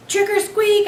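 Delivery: high, loud squeaky shout. Acted. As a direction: burst out from under the cloth. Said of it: "shout like I'd never shouted before".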